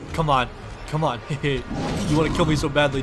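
normal talking voice

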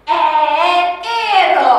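slow, clear enero to be echoed; model word for repeating. Shown as a demonstration. Teacher models enero slowly for the class to repeat. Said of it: "so after me".

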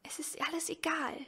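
breathy resigned whisper; a trapped girl resigned, whispering to her brother. Acted. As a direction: whisper it with eyes shut, giving up. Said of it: "flüsterte".

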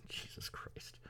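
whispered aside. Real, not acted. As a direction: breathe it under your breath. Aside under breath.

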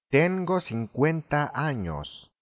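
slow, careful "cincuenta" as seen-KWEN-ta; correct Spanish model. Shown as a demonstration. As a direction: model "cincuenta" slowly and clearly. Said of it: "シンクエンタは正しいと思います". (「cincuenta」を正しくゆっくり)

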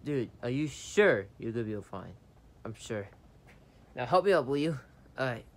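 normal talking voice

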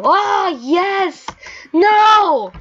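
high loud cheer turning to cry; elated then dismayed. Real, not acted. Gamer almost succeeding, high loud cries. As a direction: triumph snapping to horror.

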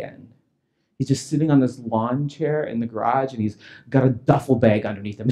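ordinary talk, nothing marked

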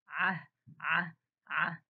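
ع voiced from the throat three times; letter sound repeated. Shown as a demonstration. Teacher to pupils, sounding out ع. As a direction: sound out ع crisply. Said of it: "sounding out the letter".

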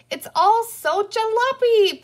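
high, loud, drawn-out yell; shouting. A narrator's reading. Girl bursts into the playhouse, shouting. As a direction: shout it slowly at a high pitch. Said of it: "Patsy shouted".

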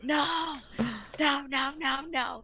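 high-pitched panicked wail, retold; mock panic, a string of high drawn-out noes; freaking out. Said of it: "I was freaking out".

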